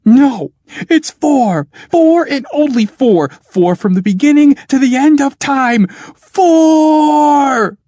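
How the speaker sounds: loud, high hoarse bellow. Acted. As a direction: bellow it till your voice cracks. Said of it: "bellowed Truro, growing hoarse".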